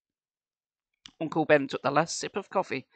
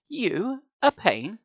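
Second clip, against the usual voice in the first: sarcastic; 6 semitones higher, much slower than usual. Acted. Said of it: "I replied sarcastically".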